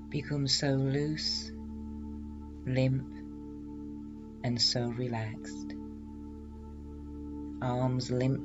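slow, soft hypnotherapy voice; hypnotic induction voice. Acted. Leads hypnosis relaxation induction.

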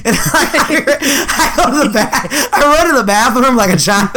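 laughing through the words; can't stop laughing while telling it. Real, not acted. Laughing while telling childish prank.

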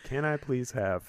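put-on loud, whining child begging; whiny kid voice. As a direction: whine it like a kid asking for a treat. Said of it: "in a whiny voice".

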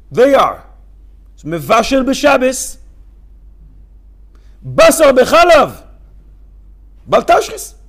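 high, loud and slow; loud emphatic listing. Shown as a demonstration. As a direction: hammer each sin out. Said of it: "lists the sins forcefully".

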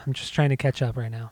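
usual speaking voice

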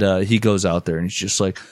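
ordinary talk

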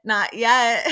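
a touch of laughter through the words; imitating a cheeky laughing reply. [name] laughing at own joke.

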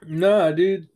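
sudden loud nah, dude; loud refusal. Real, not acted. Loud protest as friends press him to tell the story. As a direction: push back loudly at the friends.

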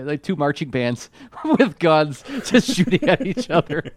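high, laughing delivery; laughing through words. Real, not acted. Podcaster cracking up at the marching-band fight scene, laughing. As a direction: talk through rising laughter.

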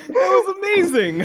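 high, slow, laughing till crying. Real, not acted. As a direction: squeal it through helpless laughter. Laughing at a joke.

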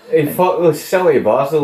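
normal talking voice

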